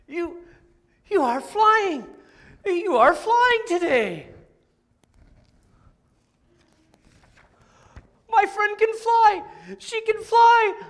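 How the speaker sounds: high, loud excited cry; loud high delighted shout; amazed cheer. Acted. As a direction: cheer on a flying friend. Friends amazed Piggy is flying.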